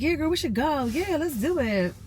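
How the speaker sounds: high, bright-eyed mock enthusiasm, pitched well up; imitating her own eager yes to a friend; eager agreement. Retelling how she agreed to invite her friend, high mock enthusiasm. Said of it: "And I was like".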